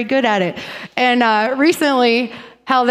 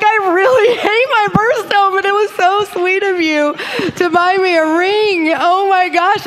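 high, laughing and gushing; 8 semitones higher, 3 dB louder than usual. Retold or imitated. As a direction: gush through giggles. Laughing retold line to husband.

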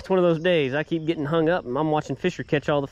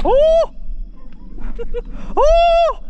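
high loud excited yells; excited yelling; 6 dB louder than usual. Real, not acted. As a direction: shout as the fish leaps. Fish jumps, excited yells.

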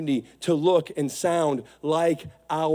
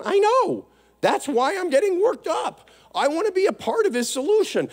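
preacher fired up, answering his own objection; impassioned preaching; 13 semitones higher than usual. Real, not acted. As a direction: let the passion boil over.

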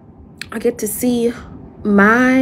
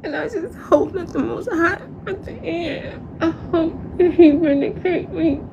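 breathy, breaking voice on the verge of tears; half laughing, half crying, pitched up; tearful; 7 semitones higher, 5 dB quieter than usual. Real, not acted. Praying the Most High vindicates him and his family, near tears.

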